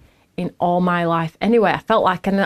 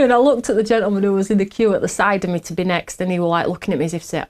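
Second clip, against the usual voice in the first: normal talking voice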